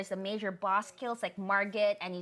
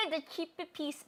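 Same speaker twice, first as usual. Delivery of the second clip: Filipino accent slipping into "Chippy piece"; Filipino accent slip; 6 semitones higher, 7 dB quieter than usual. Real, not acted. Host shouting out the PC's builder, Filipino accent slip. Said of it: "my accent came out".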